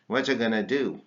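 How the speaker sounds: What you gonna do with going to as gonna; contracted. Shown as a demonstration. Teacher modelling the gonna contraction. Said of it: "contract going to in Gana".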